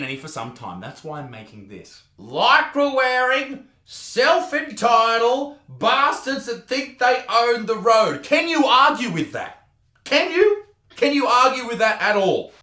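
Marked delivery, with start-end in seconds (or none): 2.2-8.2 slow, higher whiny reading; prissy cyclist voice; soy boy voice. Acted. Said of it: "So the cyclist voice will be". 8.2-12.5 goading each word; loud sarcastic challenge; taunting, higher and louder. Acted. Taunting the cyclists.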